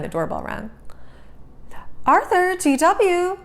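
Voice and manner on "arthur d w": put-on higher, calling kids from another room. A narrator's reading. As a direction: mom calls the children to the door. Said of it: "called Mom".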